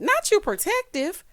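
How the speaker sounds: high, slow mocking jab; high sassy jab; mocking disbelief. Real, not acted. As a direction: tease her with disbelief. Mocking Rena.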